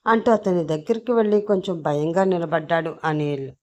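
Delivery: ordinary delivery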